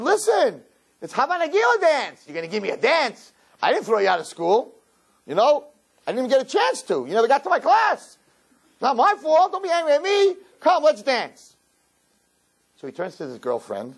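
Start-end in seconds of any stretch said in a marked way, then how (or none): 0.0-11.5 high-pitched, animated retold pleading; acted-out urging, pitched high throughout; coaxing plea. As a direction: coax him in a much higher voice. Acts out coaxing Howie to dance.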